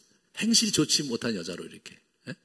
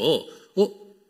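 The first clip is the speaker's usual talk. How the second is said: stern reply; 6 semitones higher, 8 dB louder, slower than usual. Retold or imitated. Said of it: "이렇게 엄격하게 답을 했는데".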